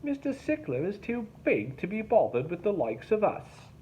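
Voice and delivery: mock the boatman to the others; snide, high sneer; sneering Derek. A narrator's reading. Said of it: "he sneered to the others".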